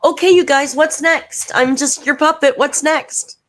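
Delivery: high, loud mocking voice of Amber. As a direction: squeal it up high like a grovelling host. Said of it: "And she literally said".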